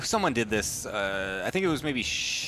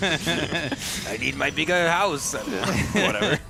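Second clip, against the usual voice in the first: slow, hammed-up French accent; 4 semitones higher, slower than usual. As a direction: drag it out in a cheesy French accent. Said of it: "French accent".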